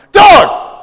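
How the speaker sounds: high, loud yelled name; sharp shout. Retold or imitated. Father calling out his son at the table, loud shout. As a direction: snap his name out to stop him. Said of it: "I'm like".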